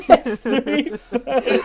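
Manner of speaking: spluttering the line through laughter; laughing while quoting. Real, not acted. Friend repeats the knockoff ult line, cracking up.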